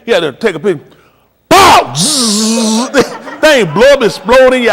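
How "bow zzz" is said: loud, high shouted pop and fizz; flash bang noise. Retold or imitated. Uncle mimics a flashbulb exploding, shouted bang and fizz. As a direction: burst out with the flashbulb sound.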